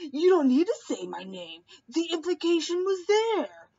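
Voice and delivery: hammed-up indignant Paul, pitch shooting up; mock Paul rising into outrage. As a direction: voice climbs high into a whiny mock protest. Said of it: "Paul going".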